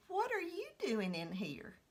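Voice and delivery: puzzled mailman. Retold or imitated. Mailman voiced in story.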